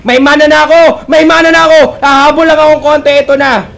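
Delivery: high, loud shout as a player; excited mock cry. Caster voicing a player who finally has mana, excited shouting.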